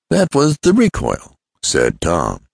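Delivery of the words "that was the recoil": "recoil" stated firmly, pitched high then falling; name it as "recoil" with a decisive fall; emphatic statement. Acted. Tom names what happened.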